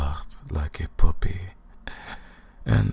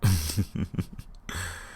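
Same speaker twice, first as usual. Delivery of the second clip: short falling villain chuckle; amused evil chuckle; 3 semitones higher than usual. Acted. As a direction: chuckle at his wasted journey. Vampire mocking her prisoner.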